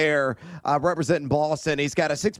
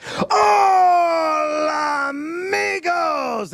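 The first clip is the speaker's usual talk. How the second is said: a big, high, stretched-out shout of hola amigos; shouted greeting; 11 semitones higher, 4 dB louder, much slower than usual. Real, not acted. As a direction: yell the greeting like an announcer.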